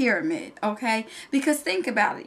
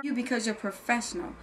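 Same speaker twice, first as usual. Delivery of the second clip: ordinary talk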